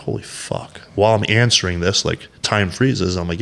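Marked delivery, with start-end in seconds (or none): 0.0-0.8 whispered realization. Retold or imitated. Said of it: "I was like".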